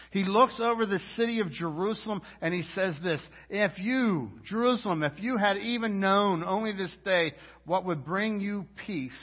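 loud, high, fervent sermon climax; impassioned preaching. Acted. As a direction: preach it full force over Jerusalem. Preaching at full force.

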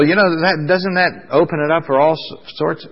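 ordinary talk

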